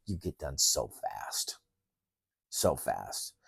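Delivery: very soft, slow and low; hushed emphasis. Real, not acted. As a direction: drop right down to a hush for effect. Hushed emphasis on quick finish.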